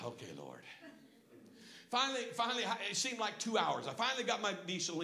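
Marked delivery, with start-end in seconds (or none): 0.0-0.6 very low, quiet giving in; hushed, resigned reply; meek submission. Acted. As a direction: yield softly under correction. Giving in to God's correction.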